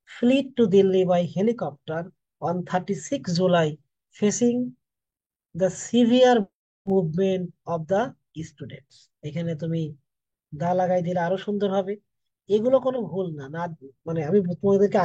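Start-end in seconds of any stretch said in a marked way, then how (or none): 0.1-8.9 very slow, word by word, spelling the sentence out; slow dictation. Shown as a demonstration. Teacher dictating the sentence to students, very slow and deliberate. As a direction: read it out slowly like dictating to a class. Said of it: "তাহলে একসাথে করে তুমি যদি এখন পড়ো".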